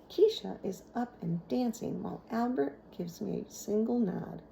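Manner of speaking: usual speaking voice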